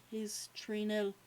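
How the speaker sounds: usual speaking voice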